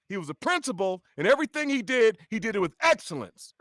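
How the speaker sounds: crisp authority of a school principal; uncle's voice. Retold or imitated. Uncle the principal speaking, high strict staccato voice. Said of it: "my uncle talks like this".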